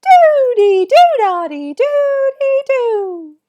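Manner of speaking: loud, squeaky, drawn-out rhythm chant; super high-pitched voice. Shown as a demonstration. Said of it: "with a super high-pitched voice".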